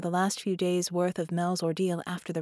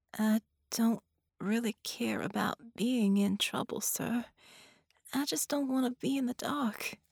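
wavering voice; 5 semitones higher than usual. A narrator's reading. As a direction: plead haltingly as a frightened patient. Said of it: "Her voice wavered".

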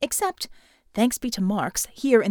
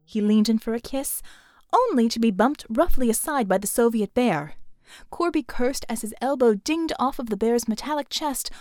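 ordinary delivery

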